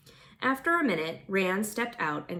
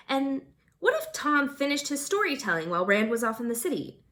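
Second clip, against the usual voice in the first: plain reading voice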